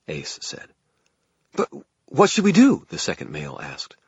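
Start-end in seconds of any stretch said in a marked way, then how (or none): 1.5-2.9 very high, loud frantic question; panicky. A narrator's reading. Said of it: "echoed in a panicky tone".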